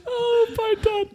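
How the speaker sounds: laughing. Real, not acted. Savouring silly answer.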